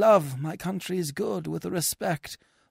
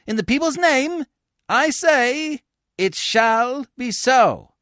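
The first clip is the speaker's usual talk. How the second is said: high, proclaiming decree; tribune's verdict; 10 semitones higher, 5 dB louder than usual. Acted. Tribune banishing Coriolanus before the crowd, proclaiming. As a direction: pronounce the sentence in the people's name.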